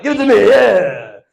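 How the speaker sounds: loud, high triumphant demand; cocky celebration. Acted. Student claiming his win from the supervisor, loud and cocky. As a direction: crow it loudly in mock triumph.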